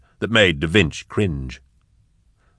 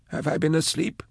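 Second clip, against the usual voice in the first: high, thin, dry frail man; small, fragile and parched; 10 semitones higher, 8 dB quieter than usual. Acted. Sark repeats the question.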